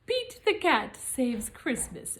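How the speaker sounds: usual speaking voice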